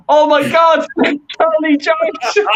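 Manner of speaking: high excited squeal, laughing through it; a mock fan's giddy shout at his idol; starstruck schoolboy. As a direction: shriek like a kid meeting his hero. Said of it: "running like a little school kid".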